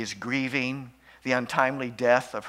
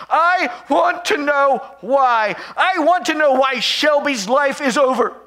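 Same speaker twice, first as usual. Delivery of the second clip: high, loud, anguished outburst that keeps climbing; a grieving mother finally breaking open; shouting her grief at God, high and loud; grief-stricken shouting; 9 semitones higher, 7 dB louder than usual. Retold or imitated. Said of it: "the dam breaks and she shouts".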